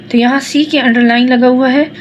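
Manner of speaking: ordinary delivery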